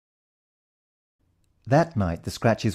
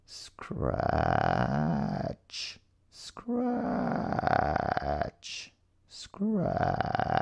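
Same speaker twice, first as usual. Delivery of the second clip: hushed, drawn-out creepy sound words; a spooky whispered scratch, scratch; eerie soft scratching; 8 semitones lower, 10 dB quieter, much slower than usual. A narrator's reading. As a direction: breathe them out like something in the dark. Eerie sound in dark room.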